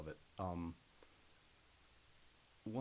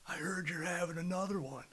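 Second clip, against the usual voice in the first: higher, louder holler at a coworker; hollering across the room; 9 semitones higher, 4 dB louder than usual. Retold or imitated. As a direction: call out to the whole break room. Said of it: "hollers as loud as he can".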